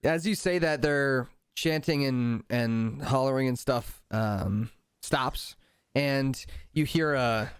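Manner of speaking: ordinary delivery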